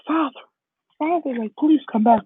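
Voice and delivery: high-pitched, mock-desperate call; pleading. Deku calling after his disappearing father, pleading. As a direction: act out a boy begging.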